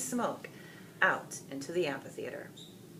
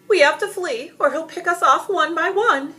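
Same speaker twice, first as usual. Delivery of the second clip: much higher, loud panicked cry; panicked yell; 13 semitones higher, 11 dB louder than usual. A narrator's reading. Audience member yells to flee in panic. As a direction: scream a warning to flee. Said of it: "someone yell".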